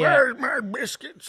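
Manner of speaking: loud, high, slow husband bellow; fat husband voice. Retold or imitated. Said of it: "pretending to be some, like, shitty fat husband".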